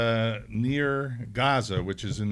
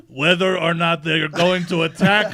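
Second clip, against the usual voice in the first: put-on Israeli accent, laughing through it; louder Israeli accent with a laugh; 5 semitones higher, 7 dB louder than usual. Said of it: "And he goes".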